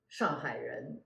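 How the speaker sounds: 上海人 said in Mandarin tones; Chinese pronunciation. Shown as a demonstration. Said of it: "people of Shanghai".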